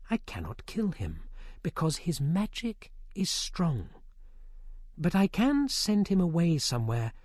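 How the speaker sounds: put-on a quiet scheming young witch; scheming sorceress. A narrator's reading. Vivien plotting against Merlin, softly.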